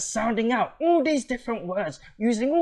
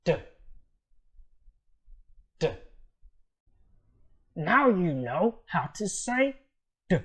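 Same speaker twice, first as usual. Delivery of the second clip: normal talking voice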